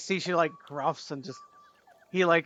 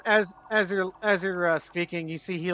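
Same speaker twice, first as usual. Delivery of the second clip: ordinary talk, nothing marked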